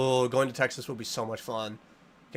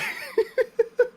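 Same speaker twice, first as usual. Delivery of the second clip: high giggle. Real, not acted. Laugh after mock line.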